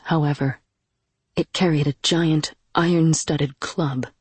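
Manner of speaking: ordinary delivery, nothing marked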